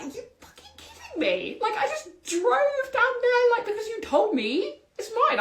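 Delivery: high, laughing mock outrage; mock livid. Host retelling her anger at the landlord, high laughing mock rant. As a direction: play at being furious through laughter. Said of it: "I am livid".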